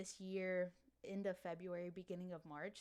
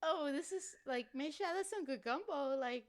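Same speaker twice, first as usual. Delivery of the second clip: higher, playful Cajun mom impression; Cajun accent; 8 semitones higher, 5 dB louder than usual. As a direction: put on her mom's Cajun accent talking about gumbo. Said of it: "she talk like this".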